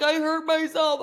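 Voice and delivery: a hint of a whiny kid; imitated whining son. Imitates son's whine.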